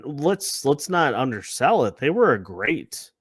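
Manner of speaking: normal talking voice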